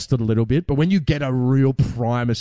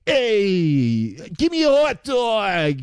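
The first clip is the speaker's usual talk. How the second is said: mock New York accent, much higher, slow; 6 semitones higher, much slower than usual. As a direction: order like a brash New York street guy. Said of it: "when you're in that accent".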